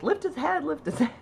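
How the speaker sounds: high-pitched re-enacted coaxing; light, high mock pleading; coaxing a baby.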